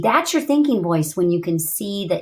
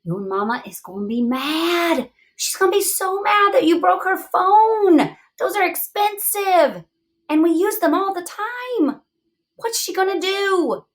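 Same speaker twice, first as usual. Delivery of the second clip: higher, worried inner voice; thinking voice; 9 semitones higher than usual. Acted. Teacher voicing her worried thinking voice, higher and anxious. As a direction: voice the worry in your head, higher. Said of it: "my thinking voice is saying".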